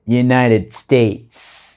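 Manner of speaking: United States drawn out very slowly; teacher modelling United States; slow model pronunciation. Shown as a demonstration.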